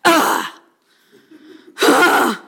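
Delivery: exaggerated annoyed teenage groan. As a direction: groan like a sulky teen. Teen groaning at chore.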